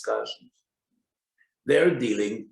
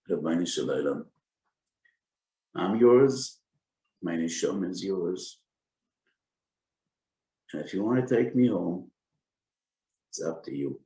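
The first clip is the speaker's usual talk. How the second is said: choked up. Retold or imitated. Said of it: "I'm choking right now".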